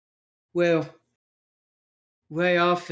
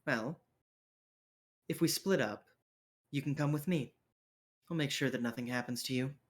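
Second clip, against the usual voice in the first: protective leader character; 9 dB quieter, faster than usual. Acted. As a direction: quietly offer to look after someone. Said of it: "looks at you and goes".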